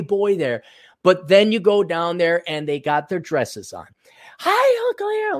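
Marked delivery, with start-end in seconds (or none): 4.4-5.4 put-on high, sweet little girl greeting; high-pitched child voice saying hi. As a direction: chirp it like a small niece. Nieces greeting him, voiced.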